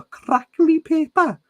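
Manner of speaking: high sing-song Welsh accent. Shown as a demonstration. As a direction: say it the Welsh way, lilting. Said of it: "Good Welsh word".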